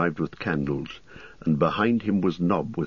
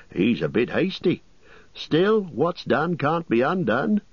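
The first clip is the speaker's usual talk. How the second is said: higher, bustling landlord voice; fussy innkeeper; 7 semitones higher than usual. Acted. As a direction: play the chatty innkeeper, pitched up.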